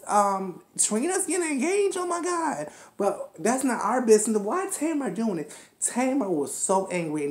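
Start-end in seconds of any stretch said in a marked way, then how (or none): 0.8-2.7 high-pitched mock astonishment; surprised reaction. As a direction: retell it as a high, excited gasp of news. Acts out own surprised reaction.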